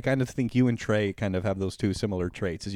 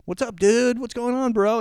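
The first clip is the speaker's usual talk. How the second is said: higher, louder bro greeting, ignoring her; Carl brushing her off; 11 semitones higher, 4 dB louder than usual. Retold or imitated. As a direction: toss it off like a buddy spotted across the room. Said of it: "And he's like".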